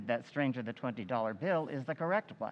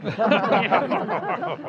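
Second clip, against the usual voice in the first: a loud amused chuckle, pitched up; hearty laugh; 11 semitones higher, 10 dB louder than usual. Acted. Chairman laughing at Mister Wilson's impatience, loud laugh. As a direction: laugh off the interruption.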